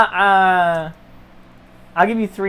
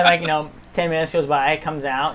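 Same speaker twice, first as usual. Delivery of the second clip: ordinary delivery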